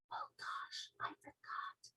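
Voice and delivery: very soft whisper; barely audible, contrite whisper; hushed apology. Acted. Sophie hushes for his ear.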